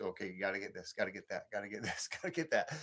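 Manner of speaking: a light, soft imitation of the wife reading her list; quoting the wife's list. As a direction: rattle off her list quietly, half-mimicking. Wife's shopping list quoted.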